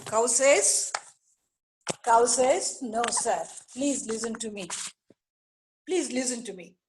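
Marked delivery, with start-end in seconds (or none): 2.8-6.8 soft, slightly lowered humble plea; meek cow begging the tiger; gentle pleading. Acted. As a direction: plead quietly to be heard. Cow begs tiger for hearing.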